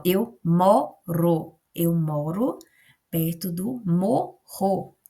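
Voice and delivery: "moro" with a tapped r, "morro" with a strong rr, very slow; teacher demonstrating the difference; slow contrast. Shown as a demonstration. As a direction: drag out "eu moro perto do morro", tap then rasp. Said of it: "olha como é diferente".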